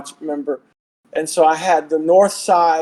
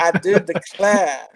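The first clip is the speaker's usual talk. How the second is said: Charleston Southern accent. Shown as a demonstration. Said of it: "Charleston, South Carolina".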